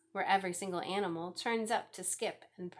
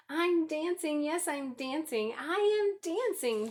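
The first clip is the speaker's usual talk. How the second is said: much higher and louder, bursting with joy; joyful cry; 7 semitones higher, 5 dB louder than usual. A narrator's reading. Gerald finds he can dance, joyful cry. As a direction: shout it out in delight. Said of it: "Gerald cried".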